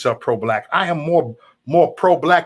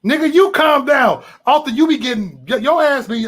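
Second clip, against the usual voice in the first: angry shout; 10 semitones higher, 5 dB louder than usual. Real, not acted. Yelling at Arthur on stream.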